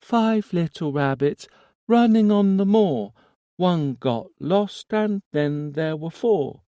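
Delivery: put-on an eerie child reciting, rising; little boy voice. A narrator's reading. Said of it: "small, infantile voice".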